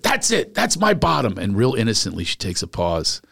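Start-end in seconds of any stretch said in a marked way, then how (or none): 0.0-1.4 fed-up snap. Retold or imitated. Snapping at the boyfriend.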